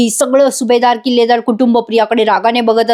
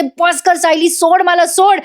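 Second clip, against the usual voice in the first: mock yell; 6 semitones higher, slower than usual. Priya pleads between slaps, high mock yell. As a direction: imitate a victim yelling to be released. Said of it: "तेव्हा तेव्हा प्रिया ओरडत असते".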